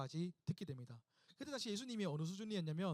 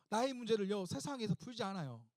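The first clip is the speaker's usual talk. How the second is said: ordinary talk, nothing marked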